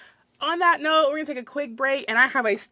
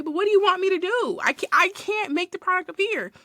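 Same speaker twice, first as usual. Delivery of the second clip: acted exasperation; 9 semitones higher than usual. Acted pleading with unreasonable customer.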